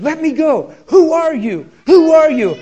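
high, loud panicked yelling, building; retold frantic shout. Woman pulling free from his grip, shouting in panic. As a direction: imitate her shrieking to be let go.

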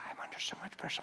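breathy whisper of a stressed mom; mother's tired whisper; whispered worry. Retold or imitated. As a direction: whisper it like a confession. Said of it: "She said".

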